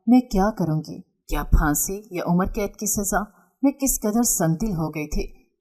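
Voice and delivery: ordinary delivery, nothing marked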